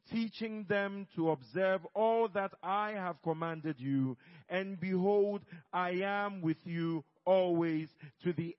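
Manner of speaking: loud, booming group recitation; congregational reading aloud. Acted. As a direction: read it out loud together. Congregation reading together loudly.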